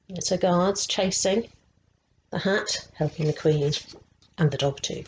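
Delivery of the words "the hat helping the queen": plain reading voice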